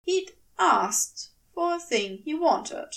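plain reading voice

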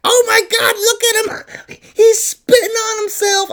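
loud, very high mimicked shriek; mocking outburst. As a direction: crow it high and cruel, imitating her. Mimicking her gleeful mockery.

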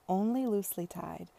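ordinary delivery, nothing marked

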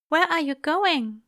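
high, anxious character voice; Aziraphale, tearful. A narrator's reading. Angel as Crowley starts to leave, tearfully anxious. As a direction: ask it high and worried. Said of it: "no longer crying, but still tear-stained".

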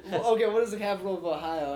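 fast, laughing through the question; laughing host. Retold or imitated. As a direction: rush the question while cracking up.

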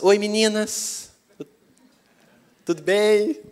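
put-on high, loud, drawn-out child's greeting; slow singsong call across the street. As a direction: imitate a girl hailing other children. Daughter calling out to girls.

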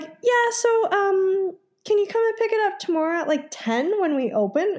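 a lightly mocked careless clerk; pharmacy clerk voice.

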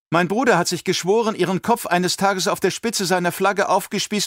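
put-on high childlike voice, cold and defiant; a child sneering at the old monster; defiant little girl. A narrator's reading. Alia answers the emperor, defiant in a girl's voice.

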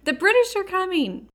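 high-pitched playful holler; mock holler. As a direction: holler a warning, just in fun.

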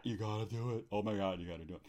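low, soft aside; low mock-pleading undertone; hushed urging. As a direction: drop down low and quiet to urge them.

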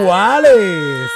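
loud, drawn-out cry of disbelief; ¡Iguales! shouted slowly in amazement; astonished shout. Real, not acted. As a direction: stretch it out, stunned and loud. Shocked reaction to release news.

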